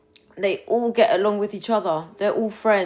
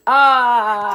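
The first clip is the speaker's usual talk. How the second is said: excited squeal; 5 semitones higher, 11 dB louder than usual. Real, not acted. Squeals as Regan joins.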